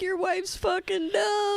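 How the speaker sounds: slow, high and loud, hammed-up blunt cop; mock detective. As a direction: drag it out like a smug detective. Mocking detectives telling husband.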